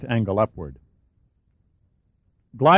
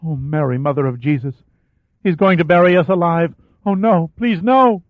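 panicked, rising to louder and higher pleading; terrified pleading cry; 6 semitones higher, 4 dB louder than usual. A narrator's reading. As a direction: beg in growing terror, building to a scream. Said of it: "Danielle let out a pitiful cry".

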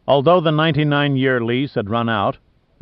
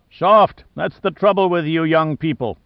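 a higher, louder scornful bark; roared; 5 semitones higher, 3 dB louder than usual. Acted. Captain mocking young officers over the wind, roaring. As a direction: throw the word out like a taunt. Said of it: "Briscoe roared over the wind".

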